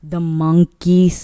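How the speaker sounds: usual speaking voice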